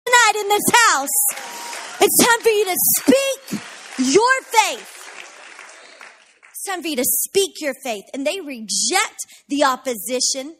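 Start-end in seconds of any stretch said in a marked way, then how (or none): 0.1-5.0 higher and louder, slow and urging; fervent preaching. Acted. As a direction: call the church to declare it. Urging the congregation.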